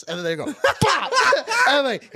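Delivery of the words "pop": sharp, high, loud mouth pop for a punch; punch sound effect. Retold or imitated. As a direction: spit out a punch noise. Friends punching him, imitated hit.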